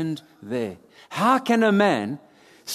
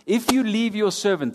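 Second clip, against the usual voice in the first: ordinary delivery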